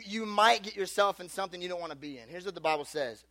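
ordinary talk, nothing marked

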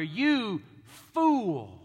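contemptuous "you fool". Pretend. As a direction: spit it at him with contempt. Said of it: "where we would say of our brother".

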